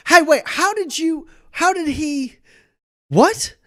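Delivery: loud, high-pitched, stumbling disbelief; bewildered outburst. Acted. Leonard realises Roland knew first, bewildered outburst. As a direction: break off, baffled, voice shooting up.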